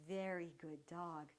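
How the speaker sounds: slow, soft, even praise; slow, soft praise for the dog; calm praise. Real, not acted. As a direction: soothe him into staying put. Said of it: "my voice is very calm and even".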